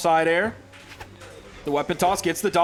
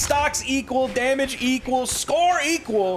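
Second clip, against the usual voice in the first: hyped caster call; 7 semitones higher, slower than usual. Real, not acted. Tense final stock call.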